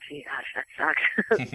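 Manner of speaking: low, soft self-critical aside; muttered under breath. Retold or imitated. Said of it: "probably said under my breath".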